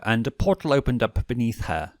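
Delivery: usual speaking voice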